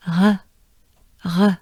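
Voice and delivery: "Re" with a throaty breathy French R. Shown as a demonstration. Teacher modelling "Re" with a French R. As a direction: keep the tongue down and breathe out "Re". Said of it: "That's the French R".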